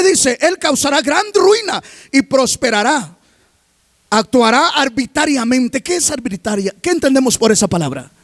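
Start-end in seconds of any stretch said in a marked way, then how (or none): none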